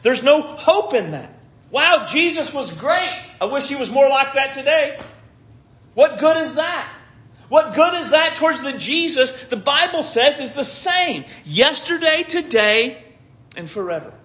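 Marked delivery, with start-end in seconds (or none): none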